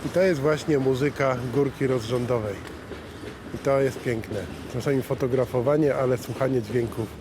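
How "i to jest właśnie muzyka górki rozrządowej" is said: ordinary talk, nothing marked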